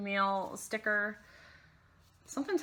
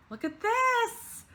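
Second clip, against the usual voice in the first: excited; 5 dB louder, slower than usual. Real, not acted. Showing mail excitedly.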